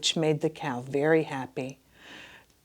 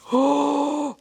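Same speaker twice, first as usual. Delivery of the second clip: gasp; 10 semitones higher, 4 dB louder than usual. A narrator's reading. Giant awakes.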